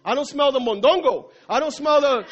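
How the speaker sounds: high, loud, laughing alarm; trapped fly panicking over missing food smells. Acted. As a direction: fret about the missing smells. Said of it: "And the fly said".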